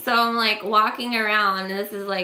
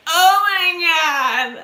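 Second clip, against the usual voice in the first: louder, higher mock horror; shocked oh my God; 7 semitones higher, 6 dB louder, much slower than usual. Woman reacting to the stranger's crude offer, loud mock horror. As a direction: gasp it out appalled. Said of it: "And I'm just like".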